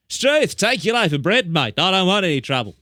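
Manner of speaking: mock Aussie ocker. Kangaroo voiced as a bandit.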